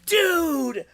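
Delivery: very high mock-furious cry; retold mad outburst. Streamer re-enacts cursing the controls, high mock fury. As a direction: curse the game in a high exasperated wail. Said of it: "I was getting so mad".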